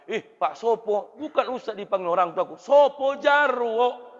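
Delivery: high, loud, slow sing-song like a clown; clownish kindergarten teacher. Retold or imitated. Preacher playing a clown for kindergarten kids, high sing-song. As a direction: stretch it out like a children's entertainer. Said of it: "Oh, kayak badut laku".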